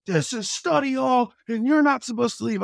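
loud, high mock coach voice; scolding coach. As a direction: lay down the rule loudly. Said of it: "He's like".

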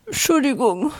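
Entschuldigung squeezed out crying, words smeared; tearful apology. Acted. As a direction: sob out Entschuldigung through tears. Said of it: "Aussprache von den Tränen verschleiert".

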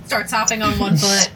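ordinary delivery

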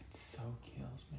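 very soft whispered groan; whispered aside. Real, not acted. Husband whispering his annoyance about the accent. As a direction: breathe it out, amused.

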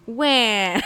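loud mock tantrum wail; wah wah whining. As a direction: wail like a sulking child, loud. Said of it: "Go on".

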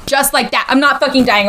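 ordinary delivery

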